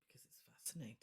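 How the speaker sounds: breathy spooky whisper. Pretend. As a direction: whisper it eerily. Mock spooky reply.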